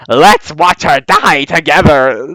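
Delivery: goofy cartoon villain voice, drawn out; King Candy. Reviewer quotes the villain's awful line, imitating his goofy voice. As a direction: imitate a goofy villain gloating, slow and hammed-up. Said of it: "the same goofy voice".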